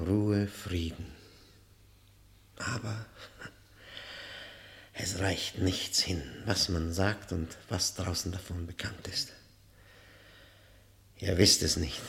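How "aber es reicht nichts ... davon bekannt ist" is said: hushed, breathy confession; whisper. Acted. Prisoner confiding to the prosecutor in a whisper. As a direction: whisper it as if a secret.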